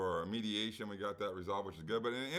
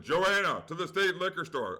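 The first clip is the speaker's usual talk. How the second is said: mock announcement, louder and higher; imitated store page; 6 semitones higher, 5 dB louder than usual. Retelling someone paging Joanna to the liquor store, mock urgent call-out.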